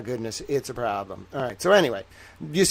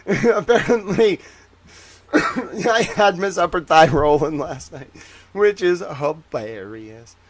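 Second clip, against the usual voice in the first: slow words broken up by laughing; laughing and spluttering through it; real laughter; 2 semitones higher, slower than usual. Real, not acted. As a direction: try to talk while cracking up. Cracking up at own story.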